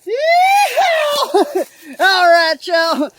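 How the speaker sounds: very high, very loud whoop with a laugh; rowdy yeehaw. Acted. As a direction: whoop like a rodeo cowboy. Redneck persona takes over.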